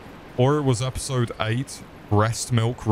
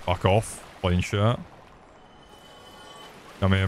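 normal talking voice

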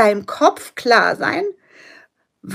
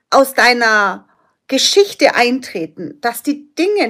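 ordinary delivery

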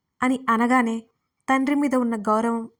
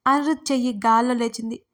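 ordinary delivery, nothing marked

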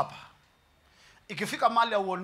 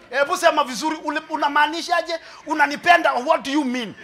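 loud, higher, demanding she explain herself; mock interrogation, pitched up and pushy; suspicious woman; 7 semitones higher, 6 dB louder than usual. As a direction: challenge him like a doubting wife. Acted suspicious woman questioning.

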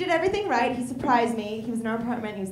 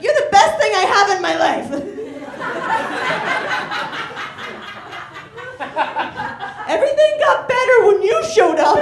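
gushing rom-com line; 11 semitones higher, 10 dB louder than usual. Pretend. Mocking rom-com lines.

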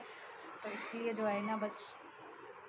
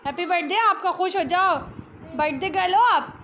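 cheerful; 5 semitones higher, 9 dB louder than usual. Real, not acted. Cheering up crying daughter.

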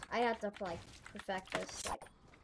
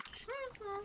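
high, mocking "ha ha", much higher pitch; mock taunting laugh; 14 semitones higher, 8 dB quieter than usual. Player taunting the trapped opponent: high mocking laugh. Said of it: "And then you'll be like".

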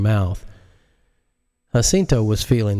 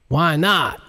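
higher, curt; higher, curt question; sharp president; 8 semitones higher than usual. Acted. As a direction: cut in fast. President snaps.

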